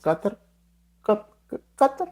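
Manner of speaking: halting, stammered tries at Qatar; pronunciation attempt. Shown as a demonstration. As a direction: grope softly for how to say Qatar, restarting on the first sound. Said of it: "I can pronounce it".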